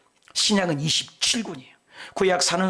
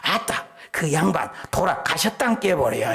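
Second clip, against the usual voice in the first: Jeolla dialect. Retold or imitated. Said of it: "전라도 사람들은 죽음에 대해서도 굉장히 길어요".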